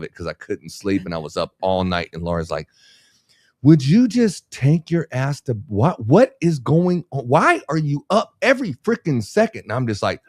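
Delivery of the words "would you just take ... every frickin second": high, loud mock scolding; exasperated wife. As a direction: imitate a fed-up wife, pitched way up. Exasperated wife, acted in story.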